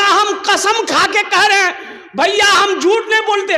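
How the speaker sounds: high-pitched, pleading insistence; acted, worked-up protest; vehement protest. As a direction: swear innocence with comic indignation. Accused man swears he is honest.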